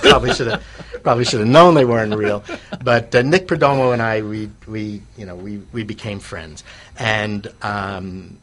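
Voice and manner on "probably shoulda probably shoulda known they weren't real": laughing through the words at himself; amused, laughing self-mockery. Real, not acted. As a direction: chuckle out the admission of being fooled. Laughing at his own naivety.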